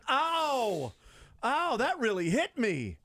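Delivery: loud pained yelp, then slow; hit hard crying out. Pretend. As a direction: cry out like you just got hit. Acting hit cameraman crying out.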